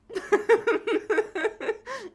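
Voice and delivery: high, rapid laughter; long run of real giggles; helpless giggle. Real, not acted. Streamer showing off a flying cat, giggling.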